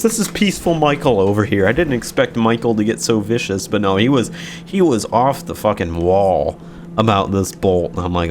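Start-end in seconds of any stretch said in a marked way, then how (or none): none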